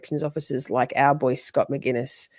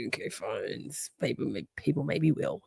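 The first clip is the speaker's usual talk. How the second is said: much lower and softer concession; grudging; 6 semitones lower, 10 dB quieter than usual. Retold or imitated. Host concedes to Joey, soft and low. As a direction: mumble it grudgingly.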